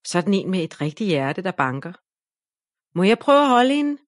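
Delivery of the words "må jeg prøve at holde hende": higher, louder eager call; shouting. A narrator's reading. Ida begging Anna for the doll, loud eager call. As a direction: beg loudly to hold the doll. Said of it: "råber Ida".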